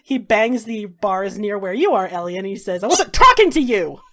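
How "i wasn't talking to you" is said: louder, sharp and hostile; angry snap. Acted. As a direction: bark it back at her. Slaver snaps at Ellie.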